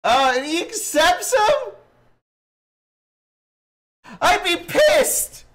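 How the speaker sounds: exaggerated offended shout; mock outraged yell. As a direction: ham up the outrage, way up high. Mock outrage at story twist.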